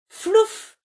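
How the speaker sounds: "flouf" squeaked high and loud. A narrator's reading. Narrator making the nut fly, high squeak. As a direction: pop it up like a nut flying.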